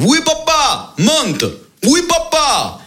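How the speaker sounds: shouted up the stairs, high; calling up. Acted. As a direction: son calling up to his father. Son answers father from downstairs. (appel crié vers l'étage)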